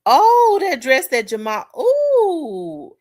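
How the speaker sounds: high, loud burst of interest; excited reaction. Real, not acted. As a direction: ooh over it, pitch shooting right up. Sudden delighted reaction to a comment.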